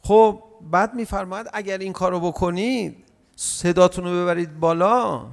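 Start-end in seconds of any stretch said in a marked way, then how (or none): none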